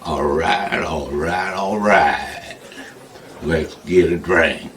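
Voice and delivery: low, laid-back drawl of all right, all right, all right; Matthew McConaughey impression. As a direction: drop into a lazy Texan movie-star drawl. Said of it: "And he say to me".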